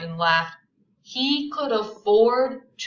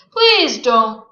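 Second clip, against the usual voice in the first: cold plea; 4 semitones higher, 14 dB louder than usual. Acted. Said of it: "Her voice was cold".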